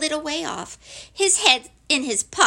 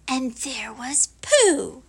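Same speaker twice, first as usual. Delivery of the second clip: ordinary delivery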